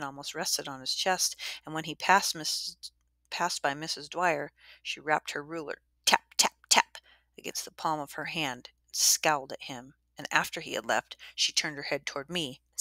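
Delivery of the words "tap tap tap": breathy tapping sounds; whispered taps. Acted. Narrator imitating the teacher's ruler, breathy taps. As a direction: tap it out like a ruler on a palm.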